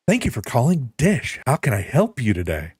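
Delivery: high, chirpy phone-agent greeting; customer service voice. Shown as a demonstration. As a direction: answer the phone like a call center. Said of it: "That's my customer service voice".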